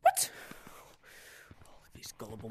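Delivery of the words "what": very high, loud squeak of disbelief; shocked shriek. Real, not acted. Seeing something unexpected in the game, a shocked shriek. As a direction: yelp in total surprise.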